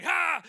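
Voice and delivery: sudden loud high scream; weird scare noise. Retold or imitated. Jumping out to scare his wife, weird noisy shriek. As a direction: burst out with a startling shriek. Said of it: "I just made this weird noise".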